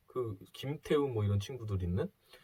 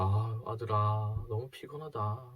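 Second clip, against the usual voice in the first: slow, softer, drained voice; weary mother; 3 dB quieter, slower than usual. Retold or imitated. Exhausted mother tells her son she is tired, slow and faint. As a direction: let each word sag with tiredness.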